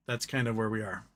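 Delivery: ordinary delivery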